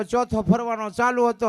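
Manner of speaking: ordinary delivery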